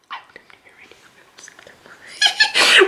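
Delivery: a tiny conspiratorial squeak; high squeaky whisper. Real, not acted.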